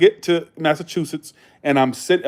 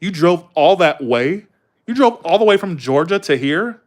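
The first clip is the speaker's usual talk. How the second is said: mock California Valley accent, slow and emphatic; stretched Valley-speak, rising questions; West Coast accent; slower than usual. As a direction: imitate an amazed Californian. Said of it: "They talk like this".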